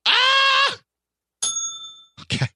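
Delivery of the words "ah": sudden high squeal of delight; real excited yelp; excited shriek. Real, not acted. Thrilled at the Blanche trivia.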